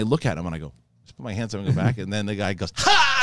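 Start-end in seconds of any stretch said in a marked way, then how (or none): 2.8-3.2 sudden high, loud burst of a yell; mock shout. Retelling a drunk guy in a bathroom, sudden high mock yell. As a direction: bark it out like a taunting drunk.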